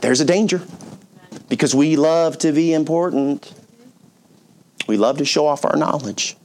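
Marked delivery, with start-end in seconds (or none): none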